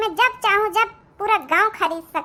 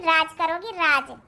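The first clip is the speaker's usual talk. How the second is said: usual speaking voice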